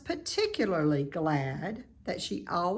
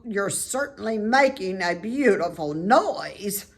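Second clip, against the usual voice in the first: snapped; 3 semitones higher, 8 dB louder than usual. A narrator's reading. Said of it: "snapped the old sheep".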